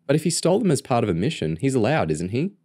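put-on high, quick girl's voice; questioning little sister. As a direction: pipe up in your brother's defence. Narrator voices the younger sister.

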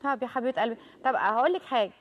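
normal talking voice

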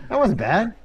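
excited praise. Real, not acted. Friends cheering a jump.

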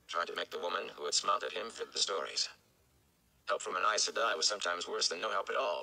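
usual speaking voice

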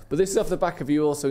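ordinary talk

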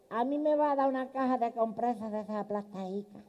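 put-on a timid old aunt asking for pads; old spinster voice. Spinster aunt asks the pharmacist, high and soft.

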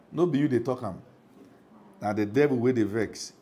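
ordinary delivery

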